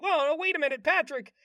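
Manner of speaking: high, worried calling out; SpongeBob. Retold or imitated. SpongeBob calling after Patrick inside the circus, high anxious voice. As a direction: pitch it up in mild alarm. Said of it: "SpongeBob's like".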